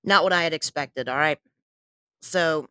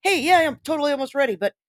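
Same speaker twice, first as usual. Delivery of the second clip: high, fast and chirpy; fake-cheerful reassurance; bright excited high voice; 8 semitones higher than usual. Retold own message to Faith.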